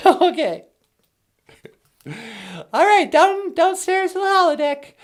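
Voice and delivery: very high, squeaky machine voice; squeaky computer voice. Acted. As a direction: play the teleporter as a chirpy gadget. Teleporter voice replies.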